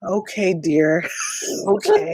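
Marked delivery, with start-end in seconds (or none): 1.1-1.6 quiet squeaky giggle; a little giggle; soft high giggle. Real, not acted. Soft laugh at partner.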